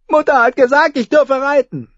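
put-on high, pleading child; whiny little boy. A narrator's reading. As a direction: appeal to what mother said.

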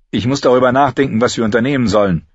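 hurried Gendry. A narrator's reading. Gendry explains he must think, hurried. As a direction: rush it out, preoccupied.

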